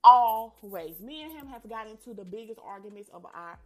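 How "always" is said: Always. blurted out much louder, one heavy word; emphatic always. Real, not acted. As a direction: slam down the single word Always. Complaining about friend's negativity.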